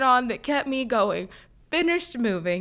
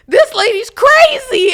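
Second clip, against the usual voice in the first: much higher, louder and slow; mocking worker; 12 semitones higher, 15 dB louder, slower than usual. Worker telling the cook about her, high mocking scoff. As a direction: scoff it out like a snide cashier. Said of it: "tells the cook".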